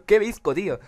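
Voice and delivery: loud, high cry of disbelief; shocked outburst. Real, not acted. Gamer yelling in shock at his own terrible aim. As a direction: blurt it out, stunned.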